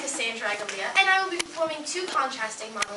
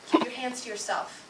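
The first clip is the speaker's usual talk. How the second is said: wounded, haunted daughter; 3 dB louder than usual. Acted. Daughter recalling mother's refusal to touch her, haunted. Performs dramatic monologue of scarred child.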